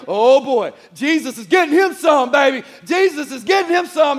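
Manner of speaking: hammed-up gleeful hype; hyped cheering. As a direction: whoop it up like a fan cheering the winner. Acting out an excited trumpet reaction.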